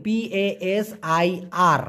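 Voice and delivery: letters spelled slowly one by one; spelling it out. Shown as a demonstration. Teacher spelling a Malay word slowly for students. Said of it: "উচ্চারণটা হবে".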